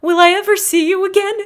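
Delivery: put-on very high childlike voice, gently pleading; mock little-girl Leia voice; imitating little Leia. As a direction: pitch way up like a small girl asking a question. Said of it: "she goes".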